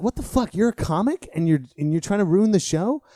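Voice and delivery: high, loud, incredulous outburst; indignant high-pitched rant; pissed off. Retold or imitated. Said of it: "I got mad. I got pissed".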